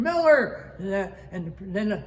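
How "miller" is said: very high, loud call; re-enacted louder yell. Crewman hollers to shipmate close to the boat, loud retold yell. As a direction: holler the name out to a nearby man. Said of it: "I yell out louder".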